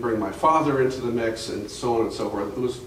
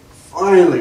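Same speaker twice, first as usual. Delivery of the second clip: relieved finally; 7 semitones higher, 12 dB louder than usual. Real, not acted. Water at last after pumping.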